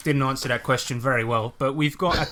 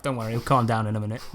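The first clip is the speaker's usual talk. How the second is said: quick offhand reassurance; 3 semitones lower, faster than usual. Retold or imitated. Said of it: "you'll be like".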